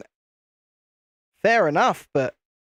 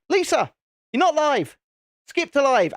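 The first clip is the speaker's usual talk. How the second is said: high-pitched call down the stream; raised, exasperated mock yell; mock shouting; 10 semitones higher than usual. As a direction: call out to get a lagging listener's attention. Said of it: "heard me shouting down the thing".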